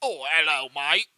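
hammed-up, nonsense English accent; mock a chirpy English mate, badly. Shown as a demonstration. Host shows his own hopeless attempt, mock English accent. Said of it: "me trying to do an English accent".

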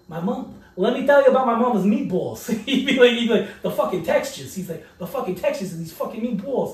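loud, high mock old-timer raving about food; imitating the old roommate. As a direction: ham up the guy bragging about his mama's meatballs.

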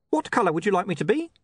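high, quick imp question; small helpful imp; eager imp. Acted. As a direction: ask it fast and high, keen to please. Imp compelled to keep offering options.

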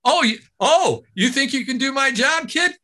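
high booming announcer voice; announcer hype. Retold or imitated. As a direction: work the crowd like a game show announcer. Announcer Johnny teasing the kid.

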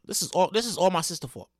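ordinary talk, nothing marked

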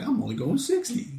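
high, light imitation of an excuse; retold innocent excuse. Retelling his innocent excuse.

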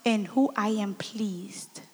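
slow breathy whisper of the verse. Acted. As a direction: whisper it reverently, slowly. Interpreter echoes verse reverently.

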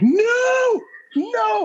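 shrill-high panicked no, no; high shocked no. Retold or imitated. Group of coworkers hearing the hot mic, high shocked no. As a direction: cry out no as the horror lands. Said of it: "all of us were like".

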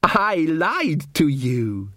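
very slow, drawn-out elf; gloating elf. Acted. Elf gloating at Magrat before the strike, very slow. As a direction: savour the lie coldly.

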